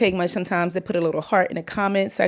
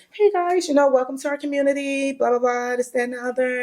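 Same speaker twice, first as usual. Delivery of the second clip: high-pitched workplace welcome; code-switched work voice; 6 semitones higher than usual. Retold or imitated. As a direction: put on a higher greeting voice for the job. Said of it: "hard for me to code switch".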